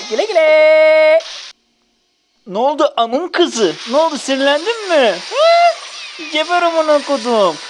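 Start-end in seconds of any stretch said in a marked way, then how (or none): 0.1-1.2 mocking taunt. Real, not acted. Taunting a killed enemy. 5.3-5.8 taunting huh. Real, not acted. Taunting enemy.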